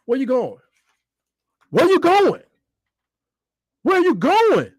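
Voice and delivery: high-pitched, incredulous questioning; agitated calling out. Real, not acted. Fan questioning a player's move, agitated.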